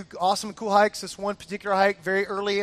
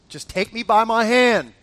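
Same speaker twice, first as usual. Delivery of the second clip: louder, firmer plea; insistent urging; 5 dB louder than usual. Retold or imitated. Husband urging scared wife down, insistent. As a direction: press the request with more force.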